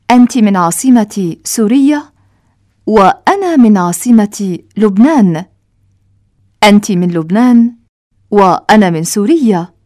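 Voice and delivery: ordinary talk, nothing marked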